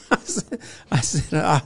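ordinary delivery

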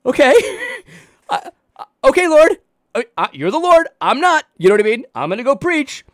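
high, loud, laughing fluster; flustered Jeremiah. Pretend. Jeremiah answering God, high and flustered. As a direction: stammer helplessly toward the Lord. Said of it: "Jeremiah's like".

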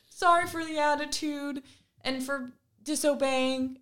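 mock meek child voice; imitating daughter's apology. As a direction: play the contrite child.

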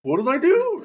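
high innocent what-did-I-do; ball boy feigning innocence. Retold or imitated. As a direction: play dumb, wide-eyed.

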